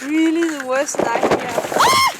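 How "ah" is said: sudden high scream of alarm; startled scream. Real, not acted. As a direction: cry out as the ice gives way. Ice cracks under their feet.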